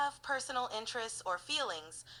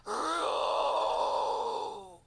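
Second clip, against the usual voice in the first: breathy imitated lion roar, higher; 6 semitones higher, 5 dB louder than usual. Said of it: "And then the roar, loud and thunderous".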